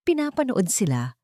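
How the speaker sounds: ordinary delivery, nothing marked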